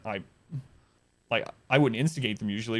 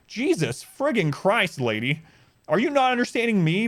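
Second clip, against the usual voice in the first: exasperated outburst; 7 semitones higher than usual. Retold or imitated. To the manager who won't listen, exasperated outburst. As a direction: spell out your disbelief, then explode.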